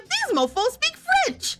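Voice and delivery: high, slow, stunned wail; drawn-out shocked squeal; stunned disbelief. Retold or imitated. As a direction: gape at the obvious. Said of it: "I'm like".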